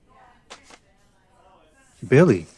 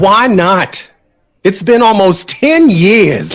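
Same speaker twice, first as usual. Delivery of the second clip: louder and higher, pushing back hard; heated protest; 6 semitones higher, 5 dB louder than usual. Acted. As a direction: argue it, frustrated and raised. Heated quarrel over leaving.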